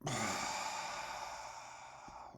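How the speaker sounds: slow audible breath out; relaxing exhale; long sighing exhale. Acted. Guided breathing, long release.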